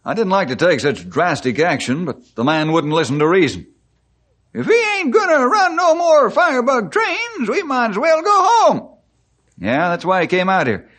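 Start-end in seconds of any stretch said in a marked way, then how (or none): 4.5-8.9 high-pitched character voice, much higher; put-on voice. Speaker on no more Firebug trains, high put-on voice. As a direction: pitch it way up, all through.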